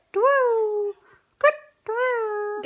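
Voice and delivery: high hooting owl call; high owl hoots. Shown as a demonstration. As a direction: twit twoo like an owl. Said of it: "Is that a convincing owl".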